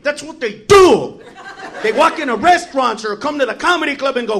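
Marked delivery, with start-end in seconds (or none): none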